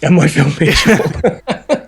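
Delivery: put-on soap hard-man voice breaking into laughter; slow, laughing character imitation; Phil Mitchell. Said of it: "that was my Phil Mitchell".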